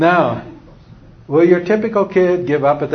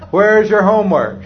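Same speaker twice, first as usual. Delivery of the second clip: raised-pitch parent demand, acted; parent asking; 6 semitones higher, 4 dB louder than usual. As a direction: ask it pointedly as a parent.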